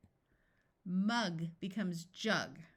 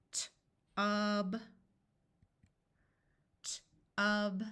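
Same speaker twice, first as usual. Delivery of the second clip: said slowly. Shown as a demonstration. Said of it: "I'm going to say a word slowly".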